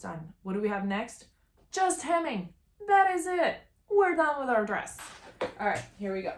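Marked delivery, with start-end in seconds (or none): 1.7-5.0 high, excited announcement; triumphant. Real, not acted. Sewist announcing the dress is done, triumphant. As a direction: ring it out high in short bursts.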